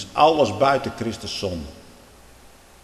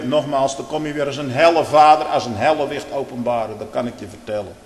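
ordinary talk

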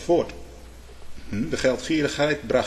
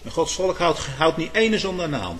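ordinary delivery

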